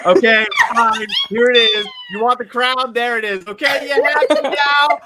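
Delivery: loud, high mock indignant rant; mock outrage. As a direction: play the wounded host, hamming it up. Mock-angry rant over crown.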